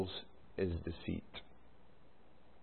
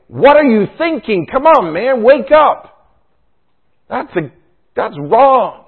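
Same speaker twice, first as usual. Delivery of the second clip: imagined rebuke; 12 semitones higher, 9 dB louder, slower than usual. Pretend. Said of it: "Jonadab doesn't say".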